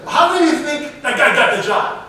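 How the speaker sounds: amused rhetorical jab at the audience; laughing question. Real, not acted. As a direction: laugh as you ask it.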